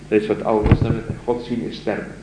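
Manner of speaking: ordinary delivery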